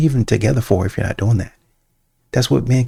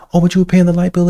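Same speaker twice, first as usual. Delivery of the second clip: mimicking partner; 8 semitones higher, 4 dB louder, faster than usual.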